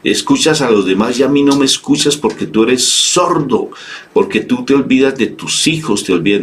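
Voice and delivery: put-on a resentful child calling God deaf; mock reproach. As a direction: scold God for not listening. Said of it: "reprochamos al Señor de una manera".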